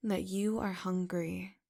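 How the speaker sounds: ordinary delivery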